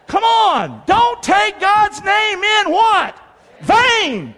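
high, drawn-out preacher's shout; rally the crowd to shout it back; rousing call-out. Real, not acted. Rousing congregation to answer.

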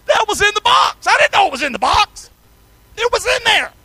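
high, loud exclaiming about the box; shout. Real, not acted. Preacher shouting to the congregation, high and loud.

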